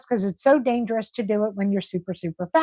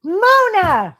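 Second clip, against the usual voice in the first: high, louder shout of her name; excited call; 9 semitones higher, 6 dB louder than usual. Real, not acted. Calling out to a viewer.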